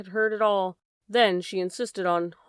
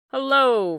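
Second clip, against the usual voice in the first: higher, louder call from the tap; 5 semitones higher, 6 dB louder than usual. Acted. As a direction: call out across the room. Calling from the tap.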